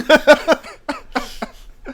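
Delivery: big high shrieking laugh; loud burst of laughter. Real, not acted. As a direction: cracks up at the punchline. Punchline of his story.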